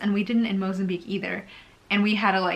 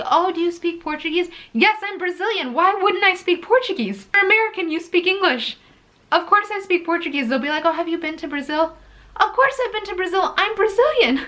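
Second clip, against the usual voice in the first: higher and louder, fed up with obvious questions; exasperated retorts; 9 semitones higher, 4 dB louder than usual. Real, not acted. Vlogger fed up with Americans doubting she's Brazilian, exasperated. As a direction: answer like it's absurd they asked.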